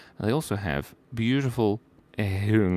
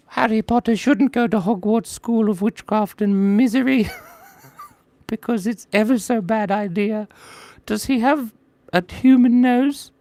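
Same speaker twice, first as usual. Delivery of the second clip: much higher, squeaky house-elf voice; Dobby voice; 11 semitones higher, 5 dB louder than usual. Acted. Viewer asks for Dobby, streamer answers in a much higher elf voice. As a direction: fret like a nervous house-elf. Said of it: "Dobby, you mean the voice".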